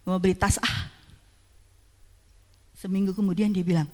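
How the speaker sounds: ordinary delivery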